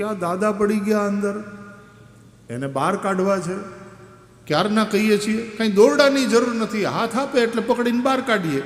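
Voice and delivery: lightly voiced children, fed up; boys complaining. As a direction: boys telling the elder what happened.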